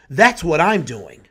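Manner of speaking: normal talking voice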